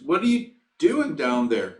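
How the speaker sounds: doctor calling down. Retold or imitated. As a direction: call it down to someone below. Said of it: "the doctor looks over the hole and goes".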